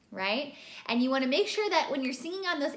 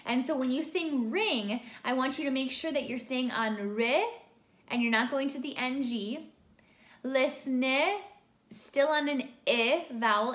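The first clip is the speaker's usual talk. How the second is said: ordinary talk, nothing marked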